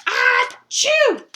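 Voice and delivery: huge high acted sneeze; big storybook achoo; biggest sneeze. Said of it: "the biggest sneeze".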